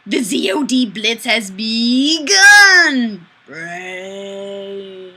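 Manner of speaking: slow, loud, dramatic zombie announcer; hammed-up zombie. Streamer reading a viewer's comment as a hammed-up zombie.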